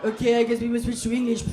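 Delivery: mock annoyed French waiter. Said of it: "angry French noises".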